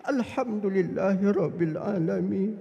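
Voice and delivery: slow, chant-like prayer with long vowels; drawn-out reverent recitation; devout recitation. Retold or imitated. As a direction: imitate a worshipper deeply absorbed. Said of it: "Nangis dia".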